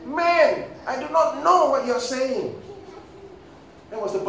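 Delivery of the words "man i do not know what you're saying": high, loud emphatic denial; Peter. Acted. Preacher as Peter denying Jesus, loud protest. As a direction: protest it much higher and louder. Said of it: "Peter said".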